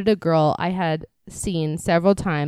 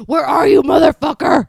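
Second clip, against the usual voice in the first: high, loud mock-furious yell; exasperated full shout; angry shout; 9 semitones higher, 10 dB louder than usual. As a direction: yell it out at the missing delivery. Acted shout from the porch.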